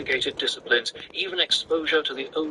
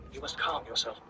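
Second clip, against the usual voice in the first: soft calming; 8 dB quieter than usual. A narrator's reading. Mother calming Paul.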